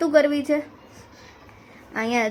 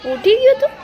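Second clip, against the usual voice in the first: put-on much higher sing-song coo to the baby; high baby talk; 12 semitones higher, 5 dB louder than usual. Mother cooing at waking baby.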